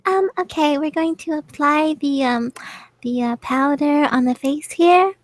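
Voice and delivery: high, sweet beauty vlogger voice; makeup tutorial. Acted. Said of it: "You sound like a makeup tutorial".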